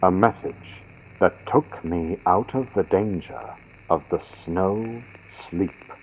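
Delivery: plain reading voice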